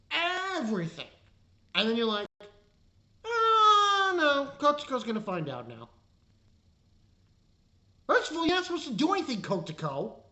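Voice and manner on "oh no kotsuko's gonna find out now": long high wailed "oh", sliding down; hammed-up mock cry, falling away; mock dismay. Reviewer mocking the show's twist, in hammed-up dismay.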